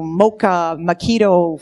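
ordinary talk

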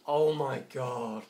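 loud, drawn-out groan of disbelief; exasperated. Real, not acted. Player failing in the game, exasperated. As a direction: stretch it out loudly, exasperated.

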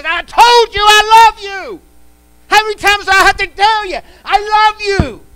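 Peter, fed up, shouting back at Jesus; Peter getting mad. Acted. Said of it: "this time he got mad".

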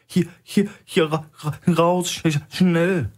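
very high, stammering on every word; trembling, breathless fake panic; shaky stammering breath. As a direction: gasp it out as if choking. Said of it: "holte mühsam und zittrig Luft".

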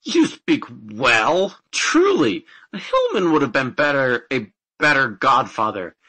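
loud, emphatic complaint; indignant outburst, then a forceful demand. A narrator's reading. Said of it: "cried the indignant godson".

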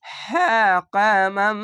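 হ্যাঁ কা মাম recited slowly, without nasal merging; slow recitation. Shown as a demonstration. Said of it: "ঈদগামে বা গুণ না". (হ্যাঁ কা মাম গুন্নাহ ছাড়া ধীরে তিলাওয়াত)

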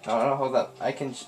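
ordinary talk

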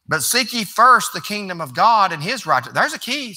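rising pulpit preaching. Real, not acted. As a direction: lift the congregation with fervor. Preaching crescendo.

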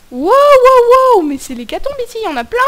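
loud high amazed wows; excited wow. Real, not acted. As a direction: gasp with glee at the loot. Finds lots of loot.

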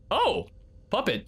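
ordinary talk

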